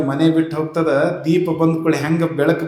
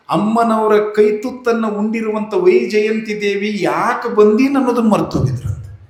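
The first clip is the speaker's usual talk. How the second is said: ordinary talk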